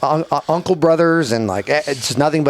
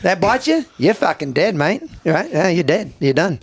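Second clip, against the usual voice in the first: higher-pitched, emphatic Aussie impression; Australian accent; 7 semitones higher than usual. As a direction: lecture them like an Australian bloke. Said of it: "Pretty, pretty good Australian accent".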